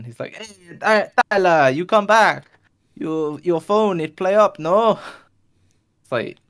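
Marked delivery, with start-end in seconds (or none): 0.3-5.0 high, loud mock-Italian accent; almost-Italian accent. Shopkeeper asks about the broken phone, hammed-up Italian accent. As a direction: ham up a loose Italian accent. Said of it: "almost Italian".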